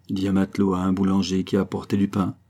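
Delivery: ordinary delivery, nothing marked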